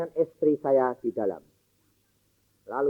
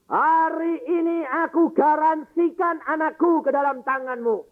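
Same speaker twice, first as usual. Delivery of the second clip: raised, higher voice of earnest prayer; fervent prayer; 5 semitones higher than usual. Acted. Father commits his dying daughter to God, fervent and raised. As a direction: plead with God, full of conviction.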